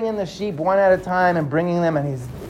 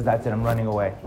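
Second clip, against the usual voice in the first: quietly determined, lower and softer; hushed resolve; 8 semitones lower, 7 dB quieter, faster than usual. Acted. Mustafa packing secretly at night, hushed and determined. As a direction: mutter it so nobody hears.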